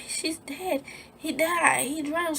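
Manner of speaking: high, soft tearful insistence; retold crying. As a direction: re-enact sobbed-out news in a small high voice. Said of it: "Why are you crying?".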